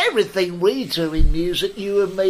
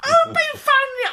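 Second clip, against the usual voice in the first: very high, louder squeak; squeaky outraged leader; 5 dB louder than usual. Retold or imitated. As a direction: shriek the question in outrage. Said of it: "he goes".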